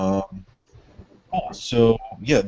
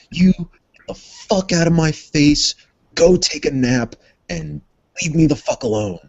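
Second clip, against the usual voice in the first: commanding yell; 8 semitones higher, 4 dB louder than usual. Acted. Jacob dominates the guard.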